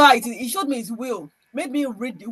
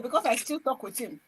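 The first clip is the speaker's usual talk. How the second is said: normal talking voice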